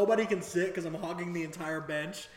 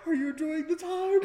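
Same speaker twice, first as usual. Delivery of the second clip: high, strained squeal; mock panicked question; 10 semitones higher, 4 dB louder than usual. Panicking rider to his girlfriend, high and strained. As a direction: ask it in a comically frantic high voice.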